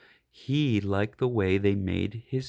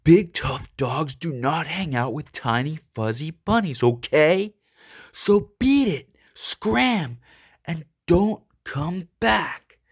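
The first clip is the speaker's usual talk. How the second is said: spelling it out; 3 semitones higher, 4 dB louder than usual. Acted. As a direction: lay down the law to the bunnies. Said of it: "he spelled it out for them".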